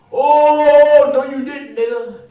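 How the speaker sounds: high, loud, drawn-out rising "oh"; hammed-up scandalized crowd reaction; imitating outraged onlookers. Bystanders to drinker who took wrong glass, mock outrage. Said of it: "then these people said".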